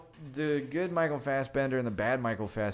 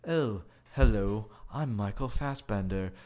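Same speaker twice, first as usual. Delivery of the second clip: clipped British android greeting; David's posh English robot voice; 3 semitones lower than usual. Retold or imitated. Robot introduces himself in an English accent. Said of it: "the other robot's like".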